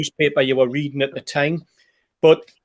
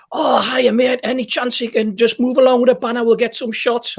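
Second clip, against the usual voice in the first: put-on butter him up like an old pal, pitched way up; mock best-mate greeting; 8 semitones higher than usual. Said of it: "like I'm his best mate".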